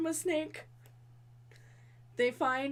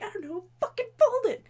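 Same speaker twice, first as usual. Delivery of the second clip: snappy retort; 10 semitones higher, 8 dB louder than usual. Retold or imitated. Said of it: "She's like".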